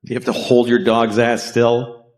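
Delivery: normal talking voice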